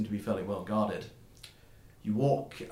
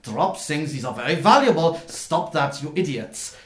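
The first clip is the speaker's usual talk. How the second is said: loud, higher fussy scolding; 4 semitones higher, 8 dB louder than usual. Acted. Cart master warns careless workers about valuable cargo, very loud voice. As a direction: shout orders at careless underlings. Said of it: "this very loud, uh, voice".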